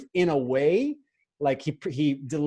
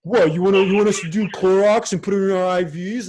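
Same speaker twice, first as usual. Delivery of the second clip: loud, incredulous mock gasp of outrage; 5 semitones higher, 6 dB louder than usual. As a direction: play them as shocked and scandalized. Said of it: "they're like".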